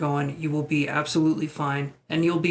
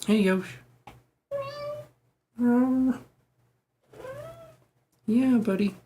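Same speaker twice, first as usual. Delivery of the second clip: talking to cat; 10 semitones higher, slower than usual. Real, not acted.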